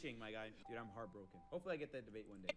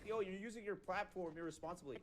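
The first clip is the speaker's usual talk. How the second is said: raised, mocking voice of a preachy streamer; mocking cringe quote; 6 semitones higher, 3 dB louder than usual. Mocking a rival's preachy lecture, higher and sneering. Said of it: "he'll say some cringe like".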